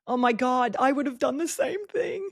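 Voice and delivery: high-pitched mock listener reaction; imitated relating listener. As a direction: lightly gush like a listener who relates. Voices relating podcast listeners.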